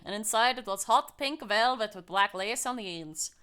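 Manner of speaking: lilting amateur Scottish storytelling; muddled Scottish-Irish lilt; bad Scottish accent. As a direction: read it in a put-on Scottish accent sliding into Irish. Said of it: "how do I do a Scottish accent".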